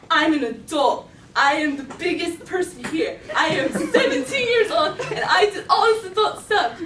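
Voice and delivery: loud, rising mock grandeur; self-important boast. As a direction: proclaim it like a puffed-up teenager. Boasting of feeling grown up.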